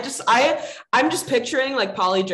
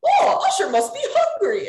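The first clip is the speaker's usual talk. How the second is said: very high cartoon doctor, amused; 13 semitones higher than usual. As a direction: retell the doctor in a squeaky put-on voice. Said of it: "he's like".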